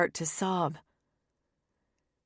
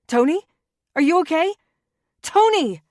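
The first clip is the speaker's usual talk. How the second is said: higher and louder with every cry of his name; panicked calling out; 9 semitones higher, 8 dB louder than usual. Acted. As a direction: call out in rising panic. Sister panicking at gunshot.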